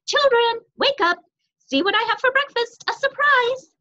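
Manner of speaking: put-on loud, high singing-out to the children; bright high-pitched mother's call; cheerful calling out. A narrator's reading. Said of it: "calling cheerfully as she went".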